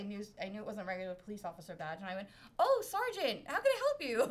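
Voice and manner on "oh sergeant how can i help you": sugary, much higher polite voice; all sweet. Retold or imitated. To the sergeant, suddenly all sweet and polite. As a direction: switch to syrupy sweetness for the sergeant. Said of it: "my tone immediately changed to being, like, all sweet".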